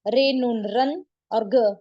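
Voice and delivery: रन and ग said as separate, slow syllables; wrong spelled-out reading. Shown as a demonstration. Urdu teacher showing learners how not to read it, split-syllable demo. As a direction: break it into रन, then ग, with a full n. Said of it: "ऐसे नहीं पढ़ते हम".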